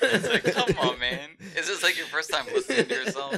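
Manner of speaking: high, laughing tease. Real, not acted. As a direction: tease him through laughter. Laughing teasing friend.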